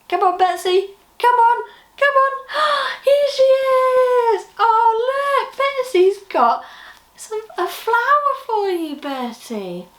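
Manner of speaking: high, loud, eager calling on, slowing on the reveal; thrilled presenter welcoming the puppet; excited coaxing. Acted. Puppet show, coaxing Betsy out.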